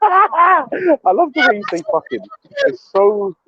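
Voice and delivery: high, laughing and slow. Real, not acted. Friend laughing at Betty's swearing, talking through a laugh. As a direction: giggle through the words.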